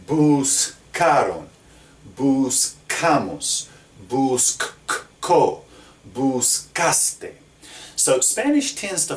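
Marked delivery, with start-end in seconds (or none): 0.1-7.3 Buscaron, buscamos each said slowly with a hard k; slow, careful, every k sound crisp; clear modeling. Shown as a demonstration. Teacher reads the forms aloud for students to hear. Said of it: "But listen to these other forms".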